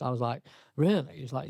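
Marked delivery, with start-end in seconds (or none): 0.8-1.2 surprised. Retold or imitated. Surprised reply to friend.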